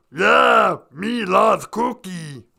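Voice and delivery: loud, low, drawn-out monster voice; big growly puppet monster; Cookie Monster. Acted. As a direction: bellow it slow and deep. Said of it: "has the same voice as Cookie Monster".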